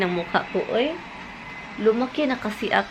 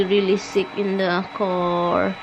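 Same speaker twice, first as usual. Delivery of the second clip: ordinary talk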